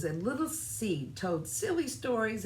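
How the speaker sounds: ordinary delivery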